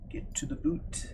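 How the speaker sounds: put-on Canadian with "boot" for boat; Canadian accent. Shown as a demonstration. Streamer demonstrating favourite Canadian phrase, mock Canadian accent. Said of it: "pronounced as boot".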